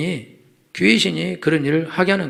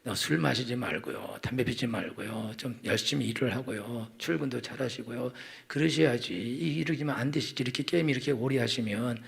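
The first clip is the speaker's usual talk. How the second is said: soft coaxing wife; 4 semitones lower, 9 dB quieter than usual. Retold or imitated. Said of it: "여러분들이 남편한테". (남편을 부드럽게 타이르는 아내, 천천히)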